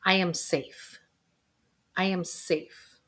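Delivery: slow, soft, steady repetition; gentle guided affirmation; calm affirmation. Shown as a demonstration. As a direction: soothe yourself with each line. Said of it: "I want you to say the words".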